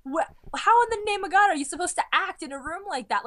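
exasperated. Acted. Fan baffled by blue-screen acting, exasperated. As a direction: demand it in disbelief. Mocks acting on bare sets.

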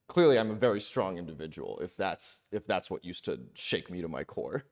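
normal talking voice

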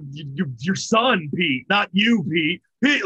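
acted-out drunken babble; mock drunk. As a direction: fumble insistently like someone trashed. Acts out drunk confusion over Pete.

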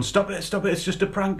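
high-pitched imitated pleading; mock plea from the prankster on the floor. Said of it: "he's sitting on the floor and he's going".

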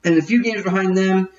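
usual speaking voice

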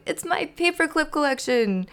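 high-pitched thrilled discovery; excited, high voice; exclaiming. A narrator's reading. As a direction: exclaim over a longed-for gift. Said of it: "he exclaimed".

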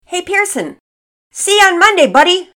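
put-on very loud, high boyish holler; calling out goodbye. A narrator's reading. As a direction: shout to a friend across the school curb. Friend calls goodbye across distance.

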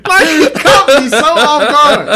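can barely talk for laughing; real laughter breaking the words; laughing hard. Real, not acted. Laughing retelling shock.

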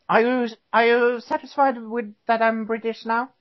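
mock British accent. Host asking cohost to approve his Britishness, mock British accent. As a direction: ask it as a proud new Brit. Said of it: "the accent's totally working for you".

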